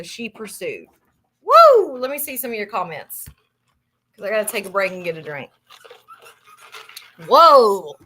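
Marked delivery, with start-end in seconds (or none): 1.4-2.0 a real burst of excitement; excited whoop. Real, not acted. Exclaims after telling the story. 7.3-8.0 much louder whoa; a real loud whoa. Real, not acted. Reacting loudly, taking a break.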